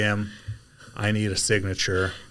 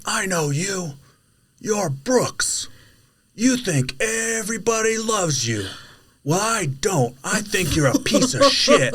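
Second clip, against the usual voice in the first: hammed-up high rant, building louder; mock angry tirade; 10 semitones higher, 3 dB louder than usual. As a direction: imitate a furious driver bellowing from down the road. Angry driver's tirade, acted out.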